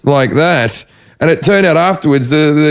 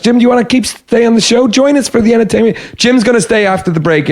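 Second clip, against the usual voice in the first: higher-pitched, chirpy presenter pitch; imitated TV hosts; 7 semitones higher than usual. TV hosts asking comic to stay on, mock-presenter voice. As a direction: lightly mimic upbeat hosts keeping a guest on. TV hosts eagerly inviting him.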